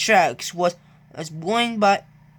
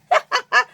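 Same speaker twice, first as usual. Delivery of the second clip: a high teasing giggle; high mocking laugh; 4 dB louder than usual. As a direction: laugh at him, high and bright.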